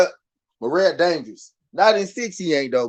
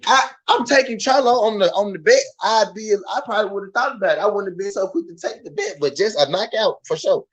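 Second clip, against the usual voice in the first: normal talking voice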